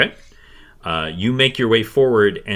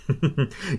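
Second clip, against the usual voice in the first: put-on quiet amused old-woman chuckle; old woman's chuckle; 6 semitones higher, 6 dB quieter than usual. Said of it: "She kind of chuckles".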